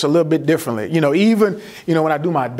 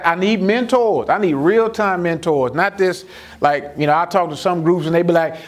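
ordinary talk, nothing marked

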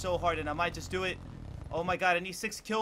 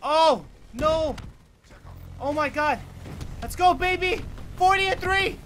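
high, loud hype yells; hyped celebration; 8 semitones higher, 8 dB louder than usual. Real, not acted. Streamer celebrating his kill count, hyped and loud.

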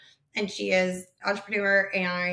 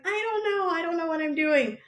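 flustered panic; 8 semitones higher than usual. Retold or imitated. Retelling his own panicked reply.

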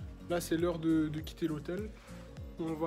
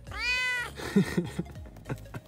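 high laugh; 5 dB quieter than usual. Real, not acted. Laughing at the animal.

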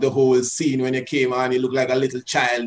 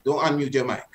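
normal talking voice

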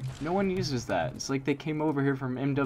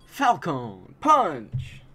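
very slow, louder drawn-out battle cry; Falcon Punch shout; 3 semitones higher, 5 dB louder, much slower than usual. Pretend. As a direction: bellow the catchphrase. Shouting a game-character catchphrase.

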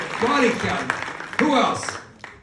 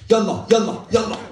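instructor; 6 dB louder than usual. Acted. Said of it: "and my instructor goes".

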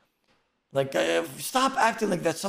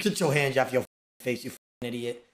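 normal talking voice

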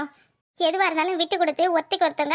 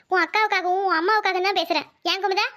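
ordinary talk, nothing marked

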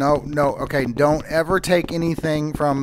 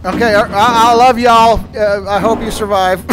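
panicked yelling while fleeing; scared and loud, running away; being chased; 6 semitones higher, 8 dB louder than usual. Real, not acted. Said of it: "That thing chased me".

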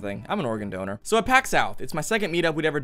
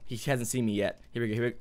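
ordinary talk

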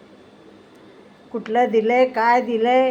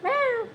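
high little mock cat meow; high playful meow back at the cat; imitated meow; 11 semitones higher, 5 dB quieter than usual. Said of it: "कसला आवाज गोड आहे".

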